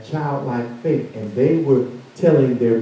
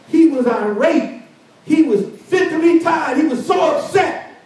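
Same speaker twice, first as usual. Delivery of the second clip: much higher, building preacher's cry; rising preaching fervor; 13 semitones higher than usual. Acted. Preacher to congregation, telling of Naaman's rage, fervent cry.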